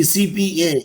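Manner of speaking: "C P A" spelt out slowly, letter by letter; each letter of "C P A" on its own; letters said separately. Shown as a demonstration. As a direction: say "C P A" as three slow letter names. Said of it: "it is initialism".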